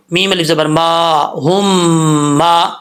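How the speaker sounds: ہم held as a nasal hum before ما; slow spelled-out ghunna demo; ghunna for one alif. Shown as a demonstration. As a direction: hum the م of ہم for a full beat. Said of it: "ایک الف کی مقدار غنہ کرتے ہوئے".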